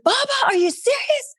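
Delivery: very high, incredulous squeak; can't believe what dad just said; sky-high stunned question to her father; shocked disbelief. Retold or imitated. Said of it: "I went crazy".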